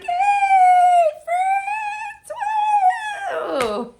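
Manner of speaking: high, sung yay; sing-song cheer. Retold or imitated. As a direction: sing it out like a happy kid. Retold own happy cheer.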